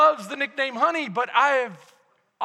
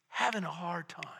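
hushed whisper; breathy hush for the struggling man; 8 semitones lower, 10 dB quieter than usual. Real, not acted. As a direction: drop to a whisper on hard time. Preacher drops voice on hard time.

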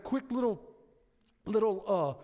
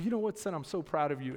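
softer, lower and tender; dad now praising his son, softer and tender; changed tune, proud; 7 semitones lower, 4 dB quieter, faster than usual. Shown as a demonstration. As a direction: say it gently, full of pride. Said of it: "I've changed my tune".

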